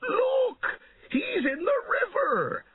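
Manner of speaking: very high excited crowd cry; piercingly high peasant yell; shouting. A narrator's reading. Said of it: "shouted the peasants".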